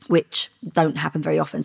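ordinary delivery

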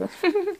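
high, light demonstrative titter; small polite giggle. Shown as a demonstration. Woman showing what her laughing was not, a small high giggle. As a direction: give a little high mock giggle. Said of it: "not just like".

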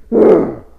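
loud exasperated "ugh"; big exasperated growl of disgust; frustrated groan. Acted. Frustrated after shouting.

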